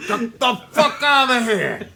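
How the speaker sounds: loud, high put-on rage with laughs; hammed-up furious producer. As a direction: rant like an insulted movie mogul. Joins mimicking the mogul.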